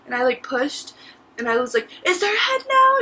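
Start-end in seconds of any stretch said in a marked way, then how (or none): 2.1-3.0 high, louder urgent asking; urgent question. Retold or imitated. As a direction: ask it desperate and strained. Pushing, asks urgently.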